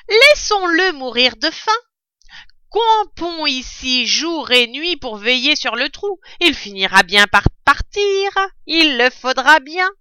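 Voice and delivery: voix de brute, plus aiguë et bien plus forte; fermier rusé. Acted. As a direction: annonce le plan d'un ton sinistre. Bin annonce son plan. (Bin annonce son plan aux autres)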